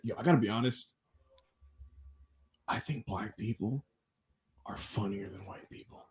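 low, hushed confession trailing into a whisper; whispered hot take. Retold or imitated. Caller confessing a hot take to the space, whispered. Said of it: "He whispered it?".

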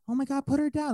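high, loud mock-panicked demand; outraged mom. Mom ordering him to put her child down, outraged. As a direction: imitate a mother freaking out. Retells the angry mom's demand.

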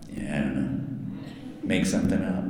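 low, offhand brush-off; imitating the director. Director telling the voice actor to improvise, low and offhand. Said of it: "'cause you ask them".

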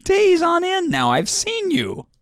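high-pitched, far brighter nurse voice. Acted.

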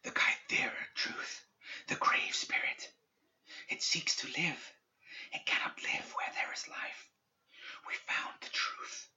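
slow whisper thinning away; fading ghostly whisper. A narrator's reading. Spirit warning Perdita as it is pulled away, fading whisper. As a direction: let the whisper drift off. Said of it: "Its whispering grew faint and tenuous".

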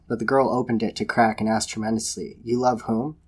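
measured storybook reading voice; story narration. A narrator's reading. Podcaster reading a dark love story aloud, in narrator voice. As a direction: read it like a fireside tale.

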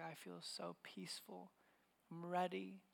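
very soft, gentle retelling of deathbed words; hushed peaceful quote. Retold or imitated. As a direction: let it drop almost to nothing, at peace. Retelling a dying woman's last words.

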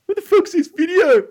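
very high, loud acted yell; mock-panicked screech at full volume; shocked outburst. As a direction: retell a drawn-out, high-pitched freak-out. Retells his shocked reaction.

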